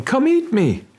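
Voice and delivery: lightly voiced bait call; mock shark invitation. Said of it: "you are saying".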